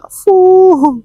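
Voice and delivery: one hard puff of breath; blowing. Pretend. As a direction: blow out hard like on a candle. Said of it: "lebih semangat lagi bantu tiupnya".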